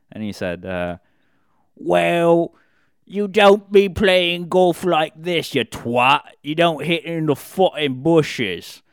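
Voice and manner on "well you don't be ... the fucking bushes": mock British yelling, high and slow; hammed-up angry British shout. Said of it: "He started yelling at us".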